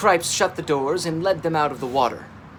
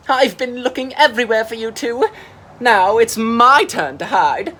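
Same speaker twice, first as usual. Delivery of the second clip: playful sea monster; 8 semitones higher, 9 dB louder than usual. Acted. Mira the sea monster teasing the friends, high and loud. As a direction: boom it out like a childish giant.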